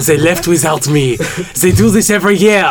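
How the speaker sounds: high, loud lisping French voice; French Sid. Pretend. Doing Sid with a French accent, high and loud. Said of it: "a Sid speech impediment while having a French accent".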